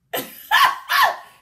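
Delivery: loud shrieking laugh; high laugh. Real, not acted. Telling the viewers about Hillary, high shrieking laugh. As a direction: burst into a high cackle.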